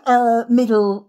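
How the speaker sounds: ordinary talk, nothing marked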